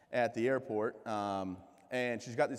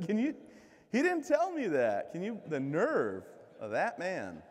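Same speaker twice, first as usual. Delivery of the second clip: mock outrage; 6 semitones higher, 4 dB louder, slower than usual. Mock outrage at medic friend.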